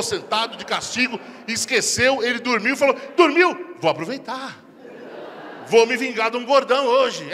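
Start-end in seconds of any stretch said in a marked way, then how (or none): 3.2-7.3 louder, slow sly relish; scheming wife. Acted. Wife slyly planning her revenge. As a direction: gloat like plotting revenge.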